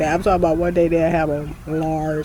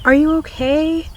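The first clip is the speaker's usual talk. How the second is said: concerned cop; 11 semitones higher, 5 dB louder than usual. Retold or imitated. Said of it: "someone's like".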